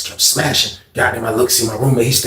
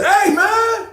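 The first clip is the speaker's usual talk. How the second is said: high-pitched shouting as the upset man whose tray got taken; high yell; slower than usual. Retold or imitated. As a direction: shout him down over a stolen tray. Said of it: "He was like".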